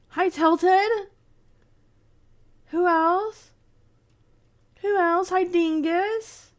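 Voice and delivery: high, sing-song hellos to chat; sing-song greetings. Real, not acted. Streamer greeting her chat in a high sing-song. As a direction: chirp each name out happily.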